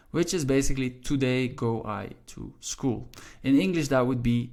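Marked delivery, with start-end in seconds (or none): 0.9-3.1 slow, word-by-word reading; measured teacher demonstrating word order; slow literal translation. Shown as a demonstration. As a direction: lay out each word deliberately.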